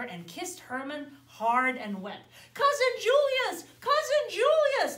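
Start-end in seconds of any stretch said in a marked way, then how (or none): 2.5-5.0 high, loud happy cheer; a crowd of bears welcoming him; shouted. Acted. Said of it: "they shouted".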